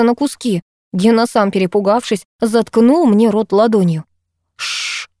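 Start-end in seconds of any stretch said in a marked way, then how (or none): 4.6-5.1 unvoiced sharp shush; hiss. A narrator's reading. Gena silencing the frightened girl, hissing. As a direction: hush her with a hiss. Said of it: "зашипел он".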